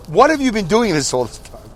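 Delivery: high-pitched put-on indignation; mock angry. Brother confronting sister about the funeral, mock angry. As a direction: play at getting angry with your sister. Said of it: "Maybe I'll get angry".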